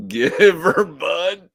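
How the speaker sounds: high, loud hammed-up war cry; mock battle cry. Echoing the Canada Man battle cry in a loud high mock yell. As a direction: bellow it like a charging hero. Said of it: "battle cries".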